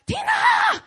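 shrill high shout of a name across the room; yelling a name. Retold or imitated. Boss shouting the intern's name across the room. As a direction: call her name as loud as you can.

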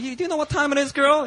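incredulous high mock yell. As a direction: squeal a retold telling-off. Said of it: "like, yelling at her now".

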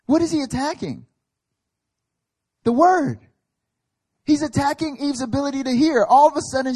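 loud, high preaching; preaching at full force. Real, not acted. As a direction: drive the point home to the congregation.

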